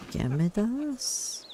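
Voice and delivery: slow, rising, puzzled question; drawn out in disbelief; surprised. Real, not acted. Surprised at shared name.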